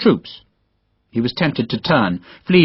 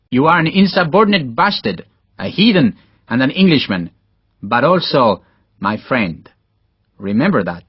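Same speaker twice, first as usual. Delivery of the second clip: Spanish officer. Acted.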